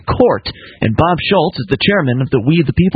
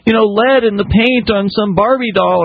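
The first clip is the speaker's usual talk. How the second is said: high, whiny fake alarm; mock a fussy worrier in a high voice; mock panic; 7 semitones higher, slower than usual. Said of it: "people are so upset about all this, like".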